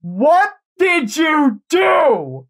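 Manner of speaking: booming, very high, slow shout; a godlike voice ringing inside their heads; drawn-out otherworldly call from within; resonating alien voice. Acted. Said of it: "a voice as if it was resonating".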